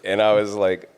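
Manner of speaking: normal talking voice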